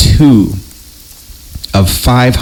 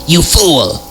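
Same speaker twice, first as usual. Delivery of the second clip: a hint of a sneering taunt, pitched up; mocking "you fool"; 8 semitones higher than usual. Smiling clerk silently mocking the visitor, light sneer. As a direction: lightly scoff it like a smug clerk. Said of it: "almost as if to say".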